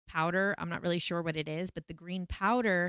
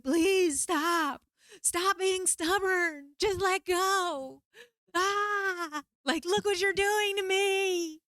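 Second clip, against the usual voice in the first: high, loud, slow unhinged begging; frantic screaming plea; 13 semitones higher, 3 dB louder, much slower than usual. Retold or imitated. Losing it in the ceremony, high shrieking pleas. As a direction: lose it completely and plead in a shrieking voice. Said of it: "I start losing it".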